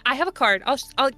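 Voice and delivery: normal talking voice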